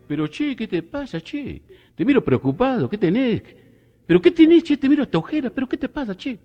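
high, rising Argentine sing-song; Argentine accent. Acted. Comedian voicing an Argentine asking his friend what's wrong, in a high Argentine accent.

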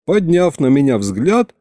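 plain reading voice

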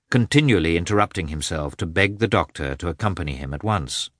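ordinary delivery

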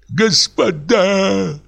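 high, very slow pleading call; pleading cry. Acted. Sleepless lodger begging the neighbours, pleading cry. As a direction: beg them to stop. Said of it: "крикнул я умоляющим голосом".

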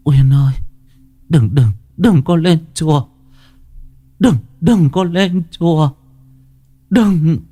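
high, urgent pleading mother voice; dying mother pleading. A narrator's reading. Dying mother begging Uyên not to climb to temple, urgent plea. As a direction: beg her daughter not to go.

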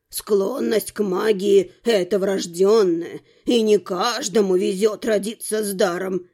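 put-on low, slow old man's voice; self-important old man. A narrator's reading. Old man explains the worlds to a newcomer, self-important. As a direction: lecture slowly in a low, old man's register.

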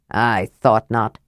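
low, slow dwarf grumble; grunted. A narrator's reading. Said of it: "Flint grunted".

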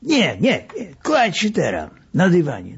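doctor hurrying the patient down; urgent orders. A narrator's reading. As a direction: push the patient onto the carpet.

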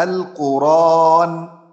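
القرآن very slow and loud, hamza moved to the ra; pausal transfer reading. Shown as a demonstration. Teacher demonstrates stopping on القرآن. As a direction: lean on القرآن slowly, no glottal stop. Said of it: "فتبقى إيه؟".

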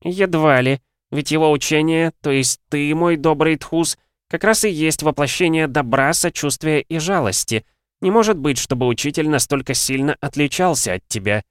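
put-on a spry, proud old alchemist. A narrator's reading. Voiced old alchemist Xarberus.

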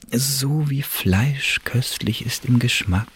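slow, savoured recitation of a poem; slow poetic recitation trailing into a whisper; reciting verse. Acted. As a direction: linger over each word like verse. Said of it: "In Reimform".

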